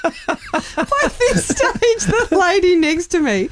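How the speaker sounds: high, slow words squeezed through laughter; laughing through words. Real, not acted. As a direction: try to talk while cracking up. Laughing while telling funny story.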